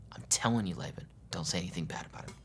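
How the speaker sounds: soft unvoiced urging; whispered warning. God warns Laban in a hush, whispered. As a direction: breathe the warning low and urgent.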